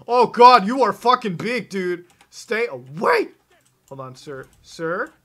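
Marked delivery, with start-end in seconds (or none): 0.1-3.3 loud, high alarmed shout; panicked yell. Real, not acted. Gamer yelling at a giant monster, loud panic. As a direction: yell in real panic at the huge beast.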